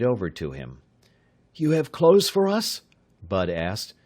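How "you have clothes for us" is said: put-on higher, eager young voice; young man voice. A narrator's reading. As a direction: bait him, sounding eager. Bud tricks the man.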